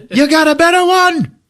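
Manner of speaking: high, loud comic squawk; defensive retort. Retold or imitated. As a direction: yell back as if insulted. Treat retorts about his boat.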